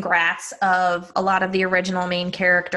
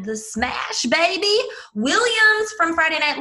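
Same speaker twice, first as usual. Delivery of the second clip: hyped nickname; 8 semitones higher than usual. Pretend. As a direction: stretch the nickname out playfully.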